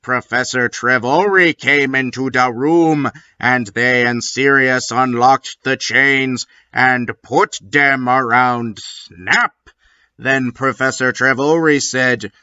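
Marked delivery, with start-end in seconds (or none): none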